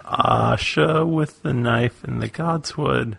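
each word spelled out slowly; slow, deliberate code phrase. Shown as a demonstration. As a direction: repeat it slow and careful. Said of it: "repeated slowly".

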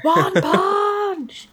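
high, drawn-out mock cry; hammed-up "special move" call; mock battle cry. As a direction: stretch it out high like a playful attack call. Mock battle cry echoing one punch.

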